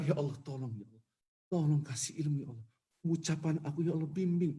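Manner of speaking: preacher begging God before a sermon; pleading prayer. Pretend. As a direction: plead quietly for words to speak. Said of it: "Doa itu harus melas".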